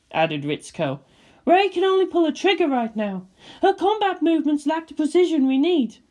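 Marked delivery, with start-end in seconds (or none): 1.5-6.0 higher voiced officer; steady worried officer; character voice.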